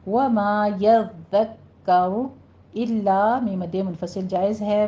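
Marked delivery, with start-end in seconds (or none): 0.1-3.4 वमा यद्दक्करु drawn out, रु heavy; measured Quran reading; slow recitation. Shown as a demonstration. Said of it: "रॉ को मुफ़ख़म पढ़ेंगे".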